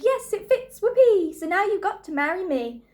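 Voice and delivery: high, loud shrieking sister; an ugly sister shrieking in triumph; gleeful scream. A narrator's reading. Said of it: "she screamed".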